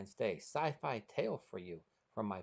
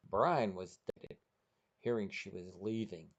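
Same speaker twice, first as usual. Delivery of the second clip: ordinary delivery, nothing marked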